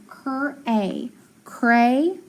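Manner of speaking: "cur A" in two parts, then "cray" joined; sounding out. Shown as a demonstration. Teacher decoding a word for class, "cur A, cray" sounded out. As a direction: blend "cur" and "A" slowly into "cray". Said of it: "So I have".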